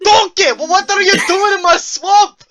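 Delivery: loud, high-pitched Scottish ogre bellow; Shrek. Pretend. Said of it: "He sounds like Shrek".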